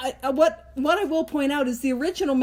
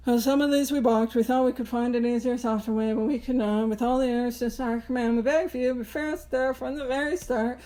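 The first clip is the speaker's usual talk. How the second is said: rote reading; 2 semitones lower than usual. Shown as a demonstration. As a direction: mumble through it as a tired recital. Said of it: "how we often hear this read is".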